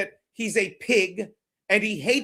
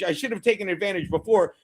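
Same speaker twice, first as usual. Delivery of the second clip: normal talking voice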